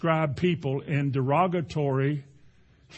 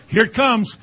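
excited; 7 semitones higher, 8 dB louder than usual. Retold or imitated. Wrench about to start.

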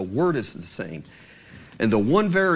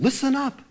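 "Listen up" thrown much higher as an order; commanding; 11 semitones higher than usual. Shown as a demonstration. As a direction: call "Listen" out high, no option given. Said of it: "It's a command".

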